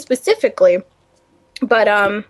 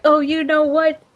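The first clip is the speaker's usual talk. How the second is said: higher mocking voice of a lobby troll; mimicking lobby trash talk, pitched up; mocking trash talker; 5 semitones higher than usual. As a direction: imitate the rude gamer in a higher pitch. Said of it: "It's like".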